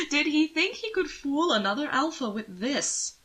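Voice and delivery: mock him gently while laughing. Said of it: "Anthony laughed softly".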